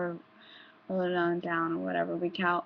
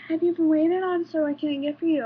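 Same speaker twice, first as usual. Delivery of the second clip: high, loud cashier chirp; imitating polite cashier; mock service voice; 7 semitones higher, 7 dB louder, faster than usual. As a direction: sound helpful and bright to a customer.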